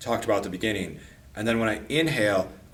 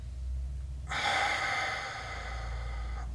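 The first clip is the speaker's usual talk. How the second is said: long slow airy breath in; deep breath in; 12 dB quieter than usual. Shown as a demonstration. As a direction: draw the breath deep into the belly. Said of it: "I can feel the breath moving deeper".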